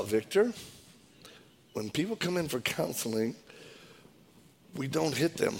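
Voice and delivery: soft, measured mild admonishment; lightly voiced patient elder, pausing between phrases; wise dude. Wise colleague correcting the counselor, softly measured. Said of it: "this is a wise dude".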